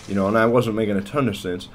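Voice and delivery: usual speaking voice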